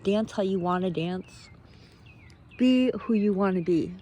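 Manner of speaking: ordinary delivery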